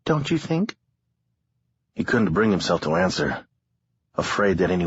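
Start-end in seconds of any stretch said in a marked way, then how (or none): 0.0-0.8 lightly voiced young woman, higher; a hint of a tired young woman. A narrator's reading. Harlow voiced in narration.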